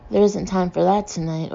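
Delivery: usual speaking voice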